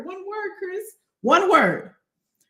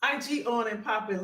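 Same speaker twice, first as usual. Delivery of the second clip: ordinary delivery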